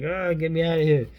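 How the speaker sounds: high, loud mock panic; hammed-up frightened airman; panicky pilot. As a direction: yelp to be let off the plane. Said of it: "He's like".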